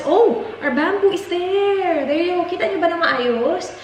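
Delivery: light up at the bamboo; excited. Real, not acted. Spots the new bamboo display. (masayang gulat na boses)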